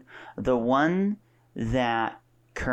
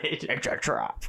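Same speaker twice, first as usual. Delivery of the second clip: Admiral Ackbar; 4 semitones higher, slower than usual. Retold or imitated. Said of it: "Is that Admiral Akbar now".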